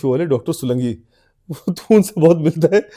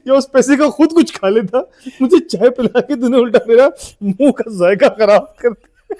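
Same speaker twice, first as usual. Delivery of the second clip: laughing; 7 semitones higher, 4 dB louder than usual. Real, not acted. Mocking friend's ungrateful complaint.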